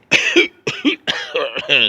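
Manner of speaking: loud put-on throat-clearing cough; loud, hammed-up coughing fit; mock choked-up cough. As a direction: cough as if choking on the word. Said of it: "I get choked up".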